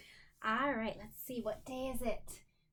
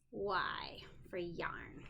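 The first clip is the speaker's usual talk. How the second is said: whisper; 5 semitones lower, 5 dB quieter, much slower than usual. Real, not acted. Phonics card reading.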